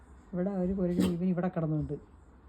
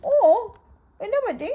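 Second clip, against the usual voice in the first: pet talk; 10 semitones higher, 4 dB louder than usual. Real, not acted. Talking to dog.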